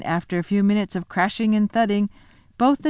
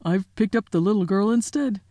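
muttering giant. Acted. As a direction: fumble an apology, flustered.

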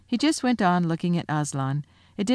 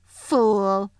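contemptuous witch's snarl; savage, scornful villainess; 3 semitones higher, 4 dB louder than usual. A narrator's reading. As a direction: spit the insult, drawn out and scornful. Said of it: "savage smile that was almost a snarl".

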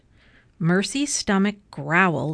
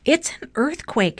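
higher-pitched storybook alarm; understated shout, pitched up; alarmed cry; 6 semitones higher than usual. A narrator's reading. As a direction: cry out in mild panic. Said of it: "shouted Missus Watson".

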